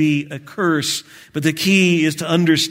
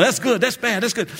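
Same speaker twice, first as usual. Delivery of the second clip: barber's refrain; 8 semitones higher, faster than usual. Retold or imitated. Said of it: "he'd go on".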